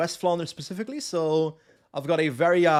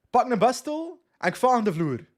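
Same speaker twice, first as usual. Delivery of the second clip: raised, louder West Flemish farmer's accent; West Flemish dialect; 6 semitones higher, 3 dB louder than usual. Shown as a demonstration. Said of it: "In West Flemish, that sentence would be".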